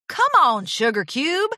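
insistent friendly nudge; urging. A narrator's reading. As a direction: coax a friend to talk. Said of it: "Applejack urged".

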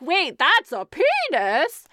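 slow, high, loud dumb shock; mock shock. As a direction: gasp it out in mock disbelief. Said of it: "Donovan's like".

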